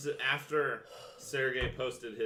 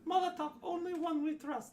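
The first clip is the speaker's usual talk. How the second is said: high frog man croak; 13 semitones higher, 3 dB quieter than usual. Acted. Frog man Benny naming Molotov the one they trust, high. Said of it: "he'll just go".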